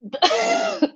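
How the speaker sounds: high squealing laugh. Real, not acted. Laughs at story.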